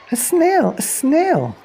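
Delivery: teacher spotting a snail on the board; startled shriek. Acted.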